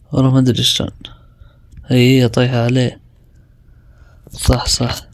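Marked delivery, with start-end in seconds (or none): none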